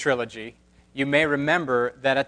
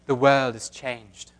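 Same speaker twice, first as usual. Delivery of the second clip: put-on slow, hushed British accent like Galadriel; a light British accent, slow and grave; 2 semitones lower than usual. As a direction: intone it in a British accent like the elf queen. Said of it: "Should I do my British accent?".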